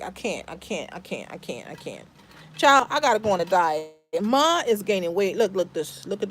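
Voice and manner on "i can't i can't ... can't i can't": trailing off lower and much softer; fading out. Real, not acted. Exasperated mom gives up on her viewers, fading away. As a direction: let it die away to almost nothing.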